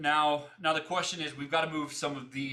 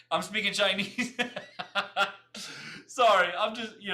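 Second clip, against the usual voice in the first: higher, louder, through real laughter; laughing; 7 semitones higher, 6 dB louder than usual. Real, not acted. Streamer laughs at own garbled speech, laughing. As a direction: crack up while apologising.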